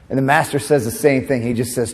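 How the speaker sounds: usual speaking voice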